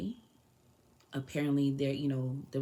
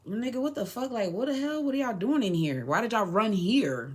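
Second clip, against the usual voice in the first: retold scolding; 6 semitones higher than usual. Scolding the men who ran in.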